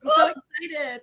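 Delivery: crying out, high and loud; excited through sobs; emotional, tearful joy; happy tears. Real, not acted. Guest answers crying happily.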